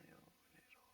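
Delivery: 은혜가 되게 breathed as a soft whisper. Acted. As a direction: hush it to the congregation. Echoes phrase as whisper. (속삭이듯 은혜가 되게)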